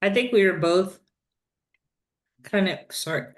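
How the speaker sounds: talking while chewing snacks; mouth full. Real, not acted. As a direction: speak around a mouthful of peas. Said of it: "I'm eating wasabi peas".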